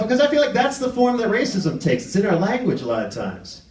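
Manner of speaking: normal talking voice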